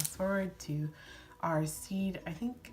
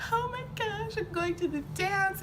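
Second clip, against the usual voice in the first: high excited squeal; thrilled; 13 semitones higher than usual. Retold or imitated. Girl reliving news of her first dance, high and thrilled. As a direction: squeal it with giddy delight.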